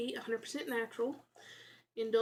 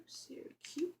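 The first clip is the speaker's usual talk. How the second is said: whispered; 4 semitones higher, 6 dB quieter than usual. Real, not acted. Cooing over candy look.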